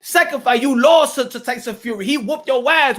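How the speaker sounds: ordinary talk, nothing marked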